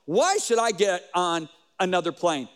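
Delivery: ordinary talk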